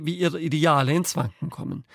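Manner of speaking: usual speaking voice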